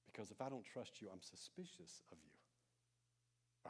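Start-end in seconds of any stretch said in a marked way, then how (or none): 1.1-3.7 whispered slowly, sly and wary; slow suspicious whisper. Pretend. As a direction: breathe it like you don't trust them. Sermon, mock suspicious aside.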